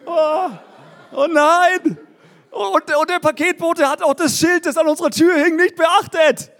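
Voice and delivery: high-pitched, whiny comic lament; mock despair. Preacher lamenting his missed laptop delivery, mock despair. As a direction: wail it in exaggerated dismay.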